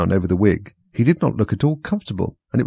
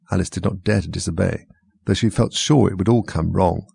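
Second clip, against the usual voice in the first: usual speaking voice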